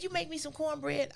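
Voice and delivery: high, lightly scolding mother; voicing her mother. Daughter imitating her mother's high-pitched disbelief about cornbread. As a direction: ask it high and pointed, like a disbelieving mom.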